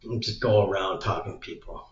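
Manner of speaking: ordinary talk, nothing marked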